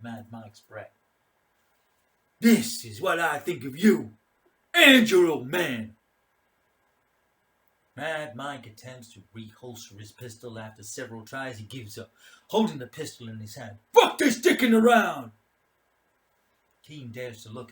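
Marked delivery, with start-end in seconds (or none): 2.4-6.0 loud, higher, slowing insult; contemptuous snarl. Acted. Mad Mike insulting King's father, loud contemptuous snarl. 13.9-15.3 loud, high angry shout; frustrated outburst. Acted. Mad Mike giving up on holstering, frustrated shout. Commander loses patience.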